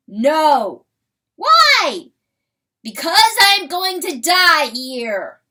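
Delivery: high, very loud exchange; loud quarrel. Acted. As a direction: shout back and forth. Quarrel of weasel, rooster.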